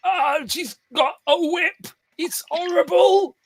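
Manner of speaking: high, loud, drawn-out cry of terror; panicked wail. Acted. Game master plays guy fleeing the whip, panicked wail. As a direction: shriek it in hammed-up horror.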